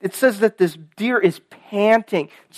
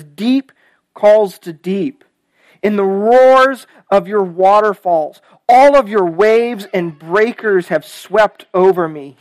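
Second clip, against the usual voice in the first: slow, weighty psalm reading; measured, emphatic pulpit reading; scripture reading; 5 dB louder, slower than usual. Acted. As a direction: let each image land with drawn-out words. Reads the psalm aloud.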